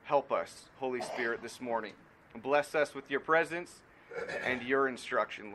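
soft, earnest prayer voice. Acted. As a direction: pray it quietly and humbly before the sermon. Opening prayer before the sermon.

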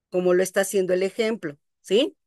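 ordinary delivery

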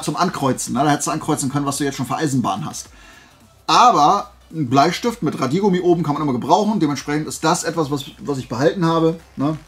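ordinary talk

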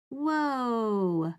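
a drawn-out, wide-eyed whoa; amazed whoa. Real, not acted. Reading a bug fact to children, amazed whoa.

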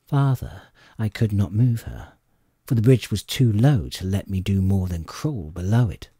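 ordinary delivery, nothing marked

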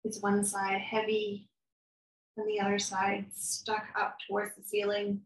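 calm, soft guided meditation voice, unhurried with long pauses; guided body scan. Acted. Therapist guiding a listener through a body scan, calm and soft. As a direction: lead them gently through the body.

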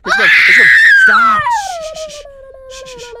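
high frantic panicked shrieks. Real, not acted. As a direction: squeal in real panic. Granny approaching, panicking.

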